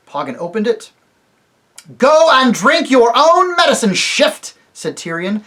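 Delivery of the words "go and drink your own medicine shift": loud, higher, scornful command; angry retort. Acted. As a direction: spit it at the traitor ape. Tyrion hurls the ape out.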